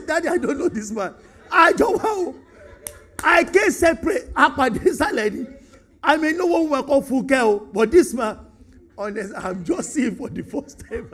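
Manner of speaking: loud, high, giggling denial; laughing denial. Pretend. Preacher mock-denying a man to his elderly dad, laughing loudly. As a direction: deny knowing him through laughter. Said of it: "That's what I would do".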